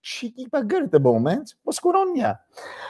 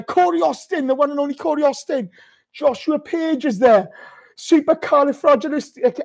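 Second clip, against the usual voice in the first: excited hype; 10 semitones higher than usual. Real, not acted. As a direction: hype the crowd up. Hyping viewer names, celebrating win.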